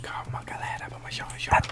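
whispering. Acted. Whispering teammate.

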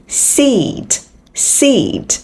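"seat" with a long clear ee sound; long ee vowel. Shown as a demonstration. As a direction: stretch the ee in "seat". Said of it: "âm y".

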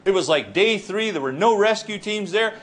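ordinary delivery

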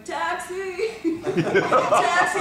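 loud laughing calls of Taxi; joking taxi call. Retold or imitated. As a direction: hail a cab as a joke while laughing. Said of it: "He's going".

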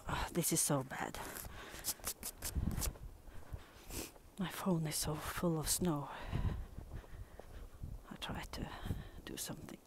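soft whisper, fed up; whispered frustration. Real, not acted. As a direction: hush it in dismay. Phone full of snow.

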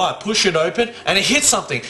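ordinary delivery

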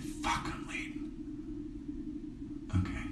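breathy hushed fluster; under her breath, realizing she's late; panicked whisper. Real, not acted. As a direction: whisper the curse in alarm.

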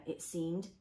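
ordinary delivery

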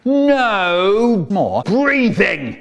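slow, loud shouted refusal; strict inspector's shouted ban; stern shout. Acted. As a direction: lay down the law, very slowly.

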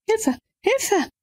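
high desperate cry of Hilfe; helpless girl cries out for help. Acted. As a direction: scream for help, trapped. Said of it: "Ich schreie doch".

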